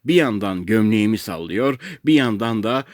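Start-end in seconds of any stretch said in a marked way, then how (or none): none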